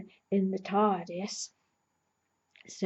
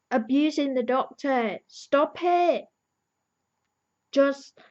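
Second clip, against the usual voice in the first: shrill, very high, loud outburst; high, loud protective rant; furious rant; 5 semitones higher, 4 dB louder than usual. Pretend. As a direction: defend the Doctor at full volume. Ranting at the women abusing Doctor.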